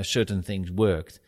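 usual speaking voice

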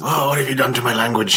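lightly voiced, mock outraged Irishman; imagined Irish listener, lightly voiced and mock appalled; offended Irish listener. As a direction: play the one Irish listener hurt by the mispronunciation.

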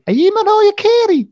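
very high, loud imitation of a kid; hammed-up high Liverpool kid voice; mock street kid. Kids poking heads into the limo, in mock high kid voice. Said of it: "they said to this girl".

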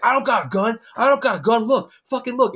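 loud, high imitation of an agitated man; high-pitched, insistent retold protest; frantic mock shouting. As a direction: imitate him yelling to prove his point. Acting out man stripping at security.

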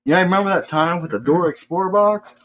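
high, fast teasing voice; mocking family. Imitating relatives teasing him, high fast mocking voice. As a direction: tease like the family does. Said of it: "It's like".

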